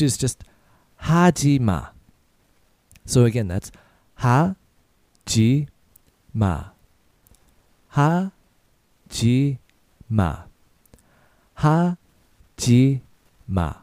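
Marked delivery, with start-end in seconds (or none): 4.2-13.7 slow, each syllable drawn out; slow syllable drill. Shown as a demonstration. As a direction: say it slowly for learners to repeat. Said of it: "So a little faster".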